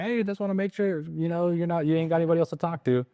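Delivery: playful. Retold or imitated. Agent playfully checking nobody else must approve, high and quick. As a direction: toss it off lightly with a grin. Teasing follow-up to seller.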